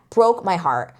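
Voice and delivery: normal talking voice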